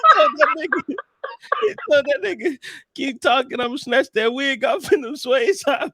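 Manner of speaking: high, laughing retelling; cracking up while quoting; laughing so hard the voice goes high. Real, not acted.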